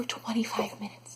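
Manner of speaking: whispered, slow confession; embarrassed whisper. Real, not acted. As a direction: drop to a whisper to admit it. Admitting how long she wandered.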